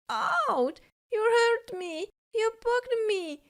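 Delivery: high, sharp yelps of pain; pained cry. A narrator's reading. Kitten poked by the hedgehog cries out in pain. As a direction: yelp it like you've just been pricked. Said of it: "he cried".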